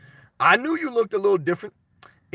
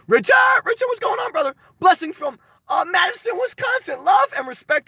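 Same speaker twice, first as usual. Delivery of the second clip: excited shout; 11 semitones higher than usual. Real, not acted. Streamer greets Richard from Wisconsin, excited high shout.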